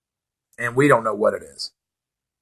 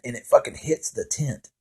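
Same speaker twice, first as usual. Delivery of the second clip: ordinary talk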